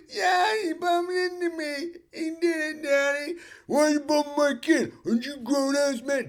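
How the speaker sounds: high, whiny accusing voice; tattling kid. Retold or imitated. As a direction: point the finger.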